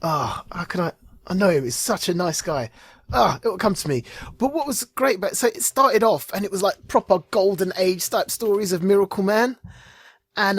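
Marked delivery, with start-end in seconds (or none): none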